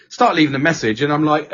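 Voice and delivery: usual speaking voice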